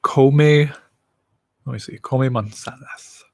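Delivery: normal talking voice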